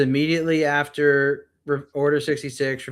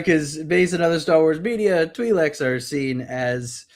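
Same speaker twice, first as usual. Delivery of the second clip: normal talking voice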